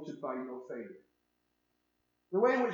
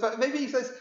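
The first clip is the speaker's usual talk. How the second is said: normal talking voice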